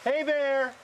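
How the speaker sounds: high shout at the bear; scaring off a bear. Real, not acted. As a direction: yell to scare it away. Yelling to scare off grizzly.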